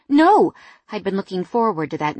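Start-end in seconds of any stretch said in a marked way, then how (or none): 0.1-0.5 sudden loud, high no; shouted no. A narrator's reading. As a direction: blurt it out in alarm. Fiancee objects to rescheduling.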